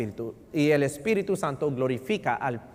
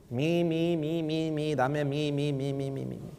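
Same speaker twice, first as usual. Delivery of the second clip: mock selfish whine; 5 dB quieter than usual. Mocking selfish people.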